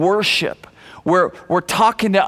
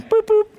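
two high-pitched toots; high "boop boop" like a cartoon pipe; Popeye theme toot; 11 semitones higher than usual. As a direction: imitate a little toot, high.

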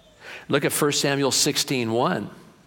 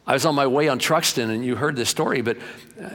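ordinary talk

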